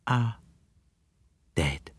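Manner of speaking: soft, falling away to near nothing; a hushed, thoughtful murmur; very softly. A narrator's reading. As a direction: let the word dead drop quietly. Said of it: "said very softly".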